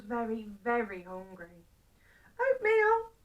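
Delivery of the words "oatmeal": high, loud, eager cry; excited exclamation. Acted. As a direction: call it out in hungry delight. Hungry old man jumps from bed.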